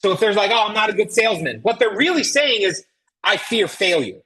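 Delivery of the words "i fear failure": usual speaking voice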